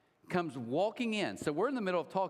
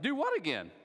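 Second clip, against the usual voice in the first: higher, sharp "Do what again?" as an indignant wife; suspicious wife; 6 semitones higher than usual. Retold or imitated. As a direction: challenge her like a jealous wife ready to fight. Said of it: "My wife's like".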